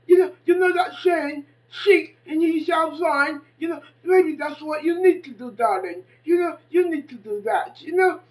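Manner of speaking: put-on loud preachy lecture to a young woman; lecturing loudly. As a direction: lay it on her like a sermon, full voice. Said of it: "I said to her".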